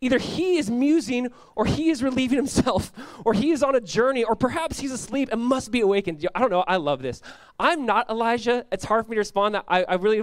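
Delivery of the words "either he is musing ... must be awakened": high-pitched taunting, read with relish; mocking. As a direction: goad them with pitched-up mock helpfulness. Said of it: "Elijah mocked them".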